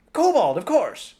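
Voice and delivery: grumpy gnome, matter-of-fact; hoarse little man. A narrator's reading. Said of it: "small, hoarse voice".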